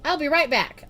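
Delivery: high loud hurried call; yelling. A narrator's reading. Girl calls to mom while running off, loud high. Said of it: "I yelled".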